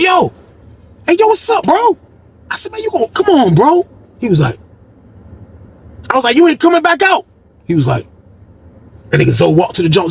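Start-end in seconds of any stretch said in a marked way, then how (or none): 0.0-7.3 high hollering at the window; pitched-up shouting to a friend; calling out across the hall. Retold or imitated. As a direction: call up to him from below.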